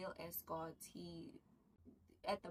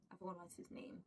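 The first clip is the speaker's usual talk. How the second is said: whisper; 8 dB quieter than usual. Real, not acted. Whispered aside to self.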